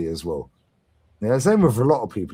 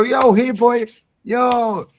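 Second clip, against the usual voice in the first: high, loud friendly dog call; much higher and louder, beckoning a dog; 12 semitones higher, 8 dB louder, much slower than usual. Shown as a demonstration. Calling a charging Rottweiler over in a high, loud friendly voice.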